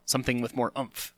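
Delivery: usual speaking voice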